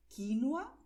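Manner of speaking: quinoa pronounced kinua; second quinoa. Shown as a demonstration. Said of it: "después".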